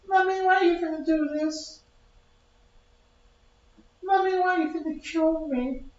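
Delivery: put-on very high, pleading little baby voice. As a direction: beg mommy like a tiny unborn child. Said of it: "the baby saying to you".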